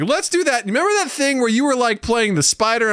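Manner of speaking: put-on high, eager child voice; pleading like an excited little kid; high childish begging; imitating daughter.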